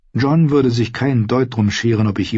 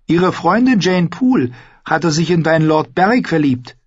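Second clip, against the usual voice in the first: put-on high, lively girl's voice; young Arya; 8 semitones higher, 3 dB louder than usual. Arya tells Ned about her friend, high and forthright. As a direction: voice a forthright girl, pitched up.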